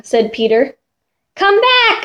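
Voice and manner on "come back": high calling-out scream. Acted. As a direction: call after her across the yard. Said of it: "I screamed".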